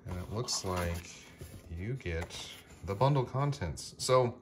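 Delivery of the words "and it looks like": ordinary delivery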